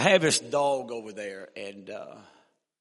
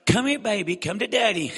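put-on high, loud sing-song baby talk; baby talk to dog; 8 semitones higher, 8 dB louder than usual. Evangelist talking to his dog like a child, high laughing baby talk. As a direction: coo at the dog like a toddler, with a laugh. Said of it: "you talk to dogs like you talk to your kids".

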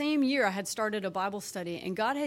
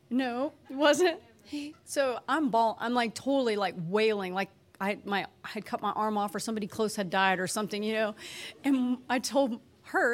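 ordinary talk, nothing marked

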